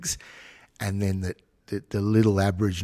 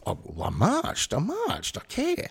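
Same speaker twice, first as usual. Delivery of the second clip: smug marcher voice, climbing far higher, mocking; 11 semitones higher than usual. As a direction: lampoon a do-gooder who only marched. Mocking a self-satisfied marcher.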